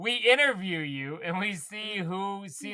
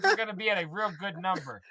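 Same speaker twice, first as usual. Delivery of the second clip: ordinary talk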